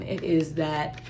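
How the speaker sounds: breathy whispered aside. Acted. Reader describing the tiger, hushed whisper. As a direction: drop to a hushed whisper on the prey image.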